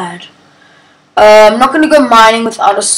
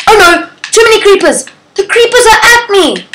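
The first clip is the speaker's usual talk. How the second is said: high, louder alarmed cries; panicked; 10 semitones higher, 4 dB louder than usual. Real, not acted. Creepers attacking her in the game, high panic. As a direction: yelp in rising panic.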